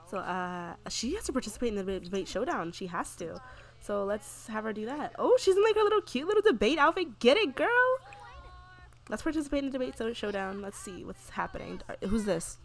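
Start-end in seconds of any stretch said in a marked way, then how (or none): 5.2-7.7 high, loud delighted cheering; excited gushing. Real, not acted. Player cheering her Sim's little debate outfit, excited.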